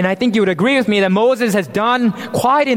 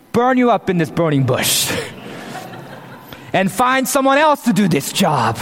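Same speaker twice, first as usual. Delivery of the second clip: higher, hammed-up tirade of insults; mock angry God; 5 semitones higher than usual. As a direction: rant like a fed-up boss, tongue in cheek, laughing at the end. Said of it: "This is how I would complete that sentence".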